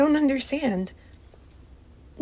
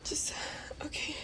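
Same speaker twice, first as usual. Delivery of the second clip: very soft whisper; tiny defeated whisper; whispered resignation; 17 dB quieter than usual. Acted. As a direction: barely breathe it.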